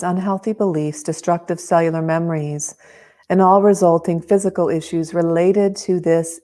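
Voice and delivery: calm, close, unhurried guiding; right in your ear. Acted. Leads a tapping meditation.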